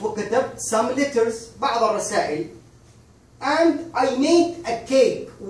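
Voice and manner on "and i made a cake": slow demo. Shown as a demonstration. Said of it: "خلينا نعمل هنا compare".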